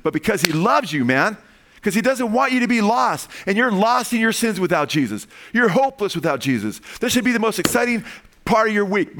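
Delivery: fiery sermon build; impassioned preaching. Real, not acted. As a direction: drive it home to the congregation. Preacher rising to full force.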